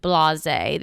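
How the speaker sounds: usual speaking voice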